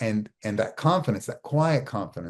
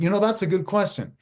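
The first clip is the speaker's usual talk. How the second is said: acted interviewee reply; 9 semitones higher than usual. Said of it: "And you're like".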